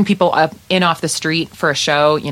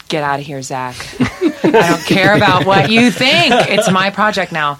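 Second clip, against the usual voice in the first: mock bossy takeover; 3 semitones higher, 4 dB louder than usual. Bandmate jokingly imagining ousting Zach, mock bossy. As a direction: pretend bully laying down the law, louder as it builds. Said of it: "those fans who are, like, thinking that I just said".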